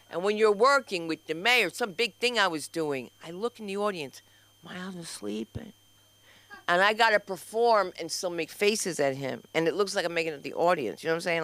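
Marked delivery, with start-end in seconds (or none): 4.6-5.7 hushed whisper. Retold or imitated. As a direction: whisper it as if he might hear. Whispering about husband in audience.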